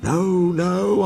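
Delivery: Toby voice, high. A narrator's reading. Toby answers the ghost.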